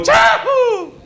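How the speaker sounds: high put-on rugby cheer; squeaky mock rugby whoop. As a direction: imitate the crowd's cheer. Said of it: "they go".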